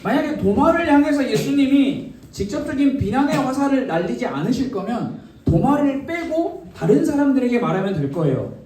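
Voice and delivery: ordinary talk, nothing marked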